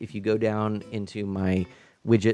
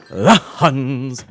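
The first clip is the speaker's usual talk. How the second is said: spit take; 11 dB louder than usual. Real, not acted. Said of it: "I just spit all over my computer".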